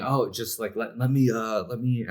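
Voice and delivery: lightly voiced tech bro pitch; a hint of a smug sales bro; frat bro. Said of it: "taking my bro voice now".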